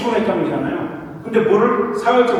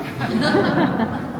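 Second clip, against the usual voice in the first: short high laugh; amused, high-pitched chuckle; 8 semitones higher than usual. Real, not acted. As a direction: laugh lightly after teasing the listeners. Laughs after teasing the audience.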